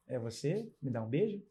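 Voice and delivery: soft, coaxing little question; tender fatherly talk. Real, not acted. Father asking his little princess for a kiss, soft and tender.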